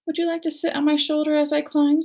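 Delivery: gentle robot mother; friendly robot voice. A narrator's reading. Robot offers ride.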